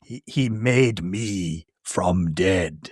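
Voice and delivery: very slow, low monster voice with a shout on "I like dead". Retold or imitated. Podcaster voicing the monster's love of death, slow and low. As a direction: drag out each word like the monster. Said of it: "I like this exchange".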